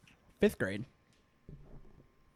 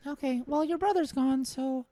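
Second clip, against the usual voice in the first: put-on little boy voice; 5 semitones higher than usual. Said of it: "little Joey".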